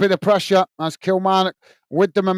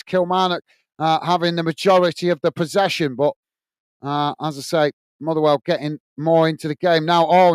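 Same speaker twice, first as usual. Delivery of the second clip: ordinary talk, nothing marked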